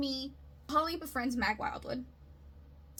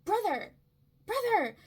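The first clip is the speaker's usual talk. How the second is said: high, loud call of Brother!; Mag calling out; 10 semitones higher, 7 dB louder than usual. Retold or imitated. Mag bursting into Holly's party, high loud calling out. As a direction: belt it out like a party crasher. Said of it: "But then Mag Wildwood shows up".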